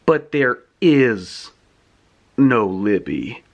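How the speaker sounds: low, slow villain reveal; ominous drop into a deep voice; deepened voice. Retold or imitated. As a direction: let the voice sink deep and drag. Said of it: "his voice deepened".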